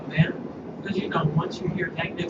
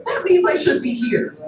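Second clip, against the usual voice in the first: proud retort; 10 semitones higher, 6 dB louder than usual. Retold or imitated. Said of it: "I'm like".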